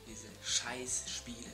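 ordinary delivery

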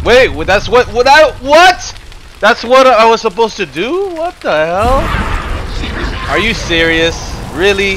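high, loud, stunned what; real disbelief breaking out; can't believe what he just saw; shocked disbelief. Real, not acted. Shocked by a game puzzle.

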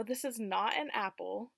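ordinary delivery

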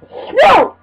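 short, high shout of dismay; frustrated yelp. Real, not acted. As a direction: yelp it as the game goes wrong. Fails in the game mid-chat.

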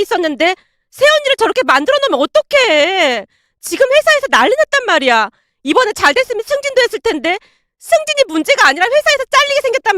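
high, frantic complaining; acted panicked outburst, pitched high; distraught angry daughter. A narrator's reading. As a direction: wail at your mother that all is ruined. Daughter rages at mother over job.

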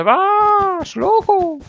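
loud, very high falsetto cry; comic high-pitched scolding; mock alarmed shout. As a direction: squeal it out, stretching the words.

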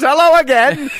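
high, loud comic wrestler voice; mock greeting. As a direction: imitate someone popping up to say hi. Voicing wrestler popping up once more.

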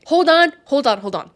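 high, loud halt; urgent interruption. Pretend. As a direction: cut the audience off with a sudden shout. Cuts off imagined commenters.

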